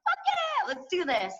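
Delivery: put-on hyped teen agreeing; excited yes. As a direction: jump at the chance. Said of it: "I was like".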